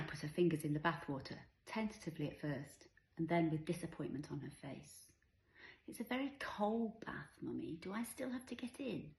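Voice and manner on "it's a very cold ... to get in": put-on high, soft small-child voice; little child's plea. A narrator's reading. Five-year-old reluctant to get in the cold bath, little child's voice. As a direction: ask sweetly and softly, pitched up.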